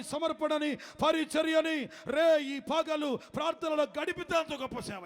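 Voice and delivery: high, driving sermon cadence; fiery preaching. Acted. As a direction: preach it hard to the congregation. Preaching at full force.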